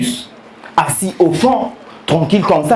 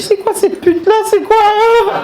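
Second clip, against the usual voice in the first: mock outrage. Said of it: "J'ai dit".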